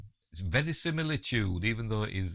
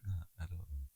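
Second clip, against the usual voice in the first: normal talking voice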